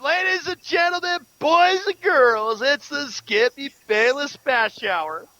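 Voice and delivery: slow, drawn-out ringside announcer; mock announcer intro. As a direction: hype up the segment like a boxing ring announcer, slowly. Introducing the show segment.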